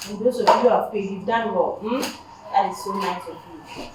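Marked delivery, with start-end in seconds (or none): none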